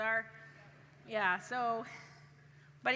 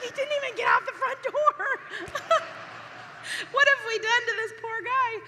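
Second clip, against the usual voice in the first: laughing; 12 semitones higher, 7 dB louder than usual. Real, not acted. Laughing at stepdad on porch.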